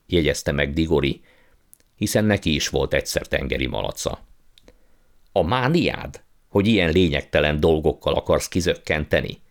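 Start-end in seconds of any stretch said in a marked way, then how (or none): none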